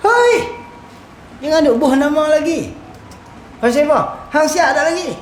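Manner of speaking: high-pitched mock scolding; acted challenge. Challenging someone who calls himself a student, scolding. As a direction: call them out, starting with a high yelp.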